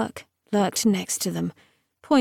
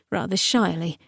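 ordinary delivery, nothing marked

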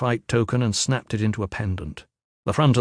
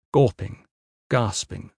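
ordinary delivery, nothing marked

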